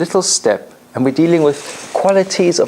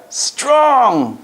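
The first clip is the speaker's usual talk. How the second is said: high, firm, ringing declaration; a powerful, commanding call of strong; strong tonality; 11 semitones higher than usual. Shown as a demonstration. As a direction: push the word out with force. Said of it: "Strong tonality".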